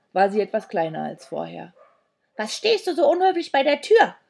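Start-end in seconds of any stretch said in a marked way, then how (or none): 2.4-4.2 loud, high, cross reproach; irate high-pitched scolding; snapping scold. A narrator's reading. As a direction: tell off a rude child sharply. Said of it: "fuhr sie Sandro an".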